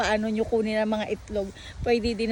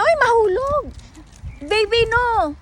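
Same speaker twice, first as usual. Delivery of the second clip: alarmed scream; 11 semitones higher, 5 dB louder than usual. Real, not acted. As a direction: cry out in sudden fright. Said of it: "Pati ako napasigaw".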